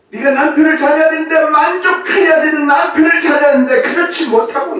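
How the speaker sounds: high, loud, fast; fast preaching shout. Acted. Preacher to congregation, fast fervent shout. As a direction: rush it out fervently.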